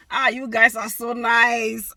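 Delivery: loud, slow, gushing thanks; excited gushing. Real, not acted. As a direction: gush over the fans.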